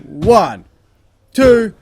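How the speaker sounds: loud rising pin count; excited count. Real, not acted. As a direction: count it up with tension. Counting the pinfall.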